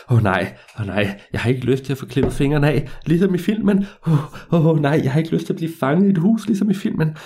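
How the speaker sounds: mounting dread with huffed breaths; frightened. Acted. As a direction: panicky breaths, pitch climbing as it goes. Frightened boy recalls horror film.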